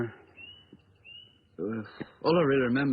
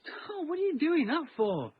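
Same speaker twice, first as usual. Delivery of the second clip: high-pitched plea to stop; startled protest; 13 semitones higher than usual. Acted. Objecting to the sudden yelling, high and startled.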